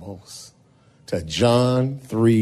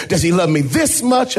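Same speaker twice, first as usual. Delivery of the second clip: higher, half-sung; young lady's sing-song; 7 semitones higher, faster than usual. Acted. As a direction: sing it out like a hopeful girl. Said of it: "she said".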